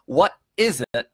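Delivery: ordinary delivery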